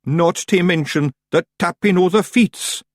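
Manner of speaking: high, slow, loud spooky Scots; self-scaring dread. Acted. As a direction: frighten yourself as you say it. Woolly continues dread.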